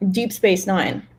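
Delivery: usual speaking voice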